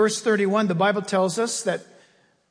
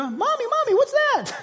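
put-on much higher, small child's voice; baby zebra. Preacher voices a baby zebra asking its mother, high and small. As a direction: squeak it out like a scared little foal. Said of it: "Can you imagine a little baby zebra?".

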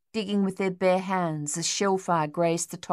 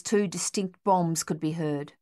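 ordinary delivery, nothing marked

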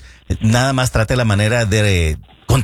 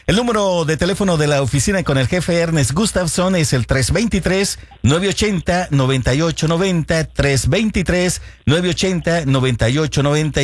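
raised, upbeat ad-read voice reciting a number; announcer read; 5 semitones higher than usual. Acted. Radio host giving listeners the office number, announcer read. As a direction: sell the phone number like a radio spot.

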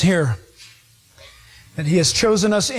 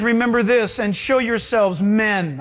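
normal talking voice

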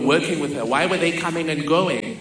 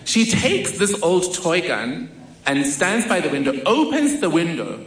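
usual speaking voice

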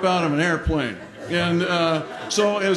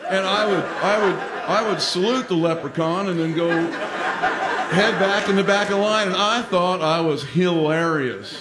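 usual speaking voice

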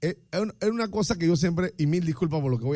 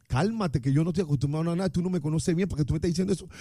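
fast defensive retort; faster than usual. Pretend. Rushed defensive reply.

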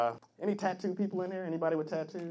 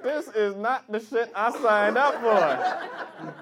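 slow, louder protest; alarmed complaint; 6 semitones higher, 4 dB louder, much slower than usual. Retold or imitated. Guy tied up in bed, slow alarmed protest. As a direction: drag it out, alarmed. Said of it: "You be like".

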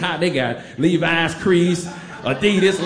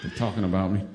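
much softer and lower, half to himself; hushed realization; 8 semitones lower, 6 dB quieter than usual. Retold or imitated. Realizing in hiding they mean him.

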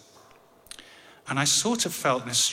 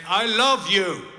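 high, loud and drawn out; retold louder repeat; 9 semitones higher, 11 dB louder, much slower than usual. Son repeating himself to deaf dad, slow loud call. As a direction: slowly shout it so he can hear.

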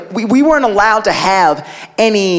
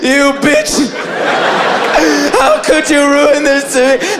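mock crying; 4 semitones higher than usual. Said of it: "Like, crying".

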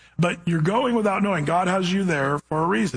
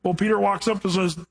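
ordinary talk

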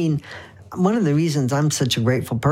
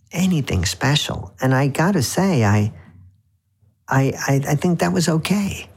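normal talking voice

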